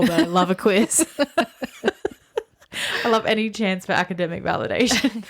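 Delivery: laughing while talking; giggling about loving a quiz. Real, not acted. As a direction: laugh through the words. Laughing through quiz excitement.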